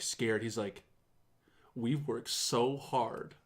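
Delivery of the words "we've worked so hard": slow, restrained mock indignation; imitated trembling anger. Presenter defending the devs to the audience, imitated contained anger. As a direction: hold the anger in, spacing out each word.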